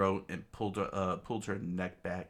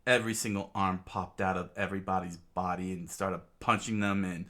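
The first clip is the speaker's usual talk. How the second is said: ordinary delivery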